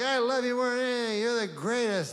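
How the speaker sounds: happy dog voice. Retold or imitated. Comic imitating his adoring dog, gushing high. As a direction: pour out love like an eager dog. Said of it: "and he's like".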